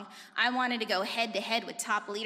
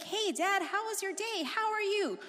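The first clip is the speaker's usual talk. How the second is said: higher, breezy hello to dad; casual phone greeting; 9 semitones higher than usual. Retold or imitated. Daughter calling her deployed dad, pitched-up greeting. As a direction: greet him lightly, pitched up. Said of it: "I was like".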